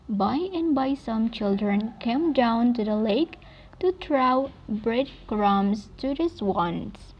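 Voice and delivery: ordinary delivery, nothing marked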